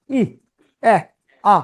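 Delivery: ই, এ, আ each cut very short; short vowels. Shown as a demonstration. Teacher showing learners what a short vowel is, short vowels. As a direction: say ই, এ, আ as brief separate vowels. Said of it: "খুব short".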